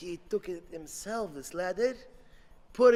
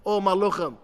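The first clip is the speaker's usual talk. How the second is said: usual speaking voice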